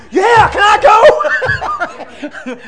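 high, drawn-out eager yell; hammed-up eager begging; excited. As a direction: shout it like an overexcited volunteer, laughing. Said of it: "I'm excited".

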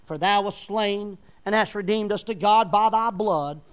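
booming King James reading; scripture preaching. Acted. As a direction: proclaim the verse to the congregation. Reads Revelation in preaching voice.

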